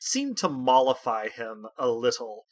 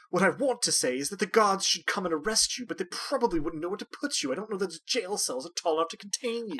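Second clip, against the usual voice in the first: put-on sneering young noble; 6 semitones higher, faster than usual. Noble insults the tall woman.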